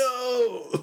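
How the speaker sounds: high, drawn-out mock "oh no"; long high-pitched comic panic; mock dismay. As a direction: cry out in exaggerated dismay. Voicing characters washed clean by rain.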